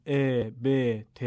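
"ا، ب، ت" with a Persian e vowel; wrong Persian-style e, e.g. be, te; Persian-accent kasra. Shown as a demonstration. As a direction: say each letter with open Persian e instead of i. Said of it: "نباید با لهجهٔ فارسی بخونیم".

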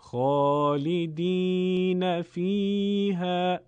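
خالدین فیها chanted very slowly, vowels stretched; قرائت کشیده; Quranic recitation. Shown as a demonstration. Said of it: "مثال رو در کتاب ملاحظه کنید".

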